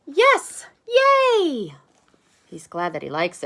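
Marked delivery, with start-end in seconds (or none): 0.0-1.8 very high, loud cheer; joyful cheer. Acted. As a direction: squeal with delight. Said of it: "And Max says".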